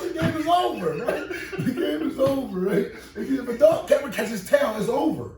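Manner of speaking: high, loud and laughing through it; excited shouting. Real, not acted. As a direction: hammer the point like a fired-up preacher. Hyped rant about the chase.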